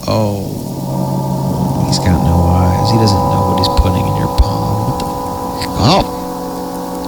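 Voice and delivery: soft, hushed disgusted reaction; creeped-out mutter. Real, not acted. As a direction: recoil quietly in disgust. Reacting scared to story.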